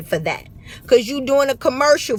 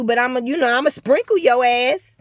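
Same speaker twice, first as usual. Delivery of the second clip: ordinary talk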